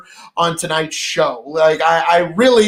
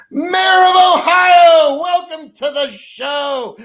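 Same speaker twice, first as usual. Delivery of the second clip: slow, high, loud announcer call; announcer shout; 8 semitones higher, 5 dB louder, slower than usual. Real, not acted. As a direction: boom out the welcome like a host. Greeting a viewer on stream.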